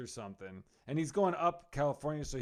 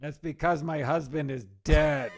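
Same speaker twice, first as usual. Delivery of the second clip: louder, hammed-up widow's wail; mock tearful; 8 dB louder than usual. Widow heckler answering the comic on stage, mock tearful. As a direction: act out a wounded, tearful comeback.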